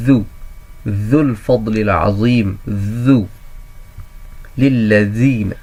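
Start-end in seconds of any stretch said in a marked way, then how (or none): 0.1-3.3 ذو said as dhu with rounded lips; teacher models ذو with pesh; letter sound demo. Shown as a demonstration. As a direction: sound out ذو, then ذو الفضل العظیم slowly. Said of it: "اور یہ ذو ہے".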